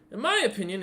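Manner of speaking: put-on high, eager boy's voice; a kid pleading his case to the hero, in a high earnest voice; earnest young kid.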